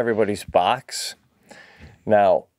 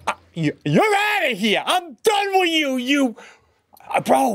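mock breakup yell; 13 semitones higher than usual. Telling Jackie he'd leave her, shouting. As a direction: kick her out in outrage. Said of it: "I go".

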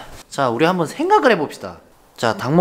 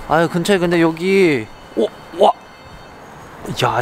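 ordinary delivery

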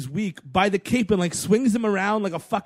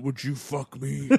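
softer, lower, slow creepy ask; softer low slow hammed-up creep; low slow mock Batman; 6 semitones lower, 7 dB quieter than usual. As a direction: murmur it low and slow, hamming it. Acting Batman wearing Superman's face.

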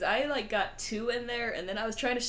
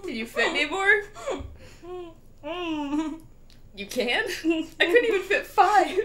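laughing; 6 semitones higher, 5 dB louder than usual. Real, not acted. Giggling at friend's attempt.

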